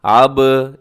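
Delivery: आब with a rebounding ब; qalqala bounce. Shown as a demonstration. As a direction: make the ब of आब rebound. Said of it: "इसकी आवाज़ को डबल करना है".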